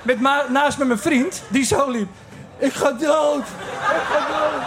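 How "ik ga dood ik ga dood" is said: high, slow, melodramatic moaning; hammed-up dying. Friend on spacecake wails he is dying, hammed-up drama. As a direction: play it like a Swedish tragedy. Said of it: "Een soort Zweeds toneelstuk was het".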